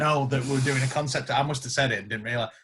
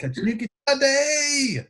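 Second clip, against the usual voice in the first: guitar excitement; 11 semitones higher, slower than usual. Retold or imitated. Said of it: "you're like".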